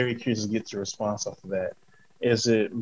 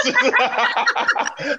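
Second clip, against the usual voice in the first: a high, loud burst of laughter; big laugh; 4 dB louder than usual. Real, not acted. Host laughing at the guest's point, big high laugh.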